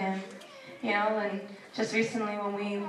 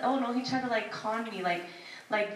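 normal talking voice

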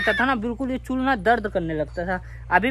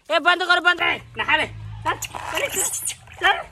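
higher pitched orders to the pets; commanding; 7 semitones higher than usual. Real, not acted. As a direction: urge the monkey into the water. Ordering monkeys to bathe. (बंदर को नहाने का ऊँचा हुक्म)

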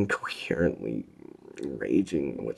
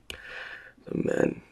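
plain reading voice

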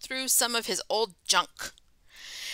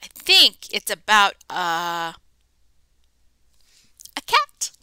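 playful guess; 2 semitones lower, 7 dB louder than usual. Pretend. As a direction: tease the reveal with a jump. Guessing the book's subject.

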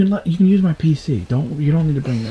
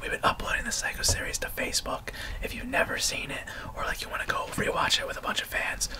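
soft ASMR-style whisper; 10 dB quieter than usual. Shown as a demonstration. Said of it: "it's like A S M R".

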